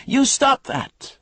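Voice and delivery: very high, loud furious cry from a window; shrill angry woman. Acted. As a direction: yell down shrilly. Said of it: "shrill, as angry as Coop was".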